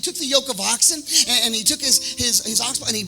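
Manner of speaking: high, urgent sermon delivery; ringing pulpit cry with stretched key words; impassioned preaching. Real, not acted. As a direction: build it like a fired-up preacher. Preaches at full force.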